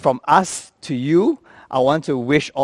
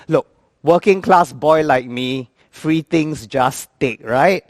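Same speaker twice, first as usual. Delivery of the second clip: usual speaking voice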